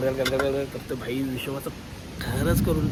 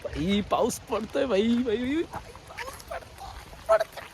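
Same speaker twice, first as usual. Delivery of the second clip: excited; 8 semitones higher, slower than usual. Real, not acted. Delighted that rain is falling.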